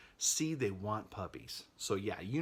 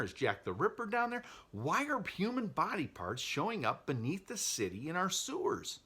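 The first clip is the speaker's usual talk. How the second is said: high-pitched, hammed-up public outcry; mock alarmed questioning; 6 semitones higher than usual. Townspeople demanding answers from the cop, mock alarm. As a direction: pile up baffled questions in rising panic.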